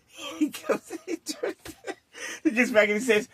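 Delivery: ordinary delivery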